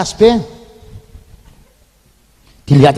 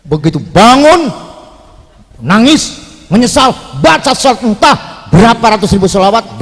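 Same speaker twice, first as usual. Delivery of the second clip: usual speaking voice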